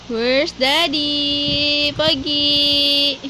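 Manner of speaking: very slow, stretched-out question. Real, not acted. Playful baby talk, drawn out.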